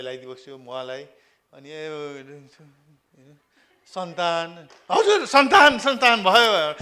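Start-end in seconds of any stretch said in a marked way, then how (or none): 4.9-6.7 much higher, louder, bursting with pride; proud announcement. Retold or imitated. Son tells his elders about the baby, bursting with pride. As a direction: announce the baby like big news.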